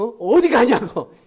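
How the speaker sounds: mock angry yell from a wife, loud and slow. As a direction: imitate a wife snapping where are you going. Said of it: "이렇게 하지 마세요". (아내를 흉내 낸 화난 외침)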